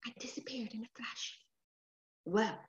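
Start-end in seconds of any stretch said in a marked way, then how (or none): 0.1-1.4 whispering. A narrator's reading. Whispered ending.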